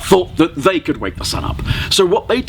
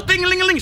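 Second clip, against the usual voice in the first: high bright jingle; bells jingle; 12 semitones higher, much faster than usual. Acted. The bat recalls women ringing bells, high jingle. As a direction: ring it like small bells.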